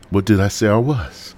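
normal talking voice

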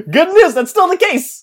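bright, high, cheery punchline; mock cheerful announcement. Real, not acted. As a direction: announce bad news as good news. Joking about lacking music skills.

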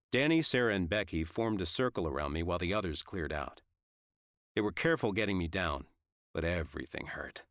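ordinary delivery, nothing marked

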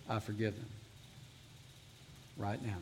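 hushed, low-pitched private vow; soft, low murmur of forgiveness; under the breath. Shown as a demonstration. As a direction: say it very softly, letting the voice drop. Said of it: "whisper it under your breath".

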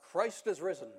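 loud, ringing shout; shouting proclamation. Retold or imitated. Rector shouting the Easter call at the top of his lungs. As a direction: belt it out to the back of the church. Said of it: "at the top of his lungs, he shouts out".

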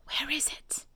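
hushed, fully whispered question. A narrator's reading. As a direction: whisper it urgently under your breath. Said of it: "and whispered".

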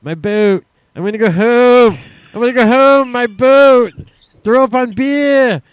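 high, very loud holler with long-held vowels; Jersey accent scream. Pretend. Mocking South Jersey for the co-host, screamed Jersey accent. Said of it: "Let's scream in, uh, Jersey Shore".

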